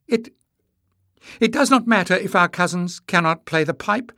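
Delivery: high, loud, stumbling start; nervous stammer. A narrator's reading. Emless defying his angry mother, nervous stammer. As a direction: trip on the first word, then push on. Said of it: "Emless stammered".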